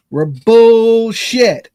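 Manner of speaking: bullshit stretched slow and loud; stressed. Real, not acted. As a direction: lean hard on bullshit. Drawn out emphatic insult.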